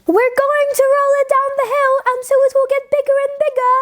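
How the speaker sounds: put-on high, fast children crying out; children shouting gleefully; excited children. A narrator's reading. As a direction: call out like eager kids on a hill. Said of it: "they cried".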